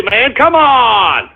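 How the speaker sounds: ordinary talk, nothing marked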